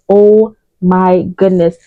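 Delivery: normal talking voice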